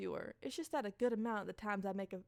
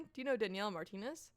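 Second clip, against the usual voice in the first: usual speaking voice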